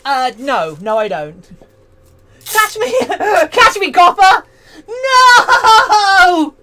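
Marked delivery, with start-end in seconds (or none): 2.5-4.5 laughing taunt. Pretend. 4.9-6.5 anguished cry. Pretend. Crying out in protest.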